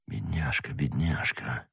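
very soft, low, pitying whisper; hoarse whisper. A narrator's reading. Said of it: "хриплым голосом шептал Атос". (хриплый сострадательный шёпот)

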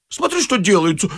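high, alarmed exclamation; frightened cry. A narrator's reading. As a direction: exclaim in rising panic. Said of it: "закричал испуганный инженер". (испуганно, высоким голосом)

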